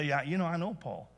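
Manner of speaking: lightly voiced devil sneering at pretenders; the devil scoffing. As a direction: brush them off the way the devil would. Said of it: "the devil's gonna look at them and say".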